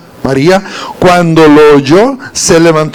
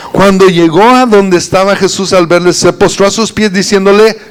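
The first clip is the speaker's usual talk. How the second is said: ordinary talk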